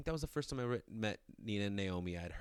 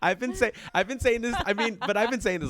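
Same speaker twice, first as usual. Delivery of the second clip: laughing protest, loud and high; excited, loud and high; 14 semitones higher, 11 dB louder than usual. Real, not acted.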